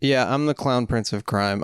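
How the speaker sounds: ordinary talk, nothing marked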